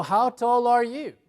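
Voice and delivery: loud, high, slow question; slow model question. Shown as a demonstration. Teacher recapping the height question, slow and emphatic. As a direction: project it clearly for learners.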